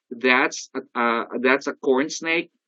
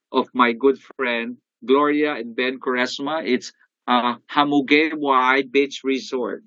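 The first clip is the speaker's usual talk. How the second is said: usual speaking voice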